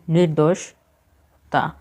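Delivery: "নির্দোষতা" drawn out slowly as nir-dosh, then ta; spelled out slowly. Shown as a demonstration. Teacher gives learners the correct spelling, slowly broken up. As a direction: pause before the last syllable of "নির্দোষতা". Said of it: "হবে সঠিক বানান".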